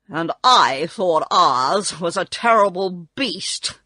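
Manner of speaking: slow, loud figure voice; Tin Woodman. A narrator's reading. Tin Woodman telling what he took Oz for, slow loud voice. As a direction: state it slowly and heavily. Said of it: "said the Tin Woodman".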